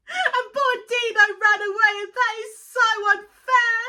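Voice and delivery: high, loud, upset protest; close to tears. Real, not acted. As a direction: wail it like it's so unfair. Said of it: "you're starting to make me cry".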